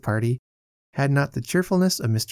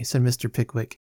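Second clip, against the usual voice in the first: plain reading voice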